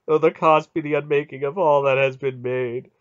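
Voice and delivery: loud, grieving god's lament; anguished groaning. A narrator's reading. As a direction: cry it out in bitter despair. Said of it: "Mara groaned".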